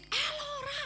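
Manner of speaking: high-pitched retold cry of the name; a hint of panic on the name; mock scream. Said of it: "saudara saya jerit".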